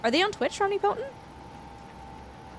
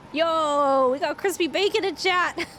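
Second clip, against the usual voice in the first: high, excited stretched-out cheer; delighted hype; 6 semitones higher, 3 dB louder than usual. Real, not acted. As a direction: hype up the chat's joke, about to laugh. Delighted hype at chat joke.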